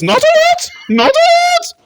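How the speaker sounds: squeaky, high, loud exclamation; hammed-up high punchline; catchphrase impression. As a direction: squeal it like a TV entertainer.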